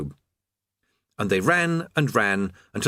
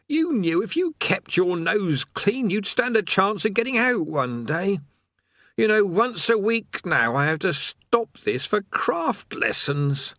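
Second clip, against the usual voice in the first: high, slow, put-upon prisoner voice; a tired old convict grumbling on; weary chained man; 11 semitones higher, slower than usual. Acted.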